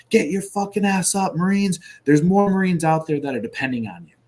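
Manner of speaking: imitated sergeant's high, loud yell; retold screaming order. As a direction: rouse the troops like a shouting British sergeant. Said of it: "he starts screaming".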